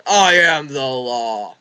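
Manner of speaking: slow, loud booming cop voice; mock tough cop. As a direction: boom it out like a power-tripping cop. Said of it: "I mean".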